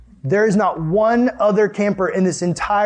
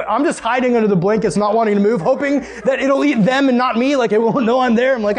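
usual speaking voice

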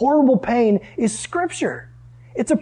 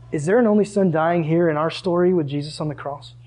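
ordinary talk, nothing marked

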